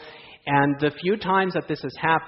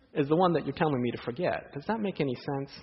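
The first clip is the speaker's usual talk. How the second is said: ordinary talk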